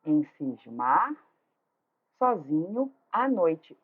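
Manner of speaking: measured syllables with a pause after "cismar"; slow scansion. Shown as a demonstration. Teacher points out the verse, slow measured beats with "sozinho à" linked. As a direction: say "Em cismar", pause, then slowly link "sozinho" to "à noite". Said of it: "é aqui, ó".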